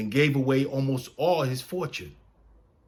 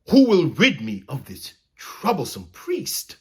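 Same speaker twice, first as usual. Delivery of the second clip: loud, high royal bellow; 5 semitones higher, 8 dB louder than usual. A narrator's reading. King Henry fuming at his knights, bellowing. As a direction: roar it out in fury at the room. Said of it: "he bellowed".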